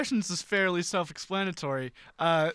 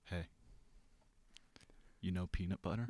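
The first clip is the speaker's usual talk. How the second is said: low, hushed salesman-like impression; George Washington Carver; 11 semitones lower, 17 dB quieter than usual. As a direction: confide it very softly and low. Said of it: "Give me your best George Washington Carver".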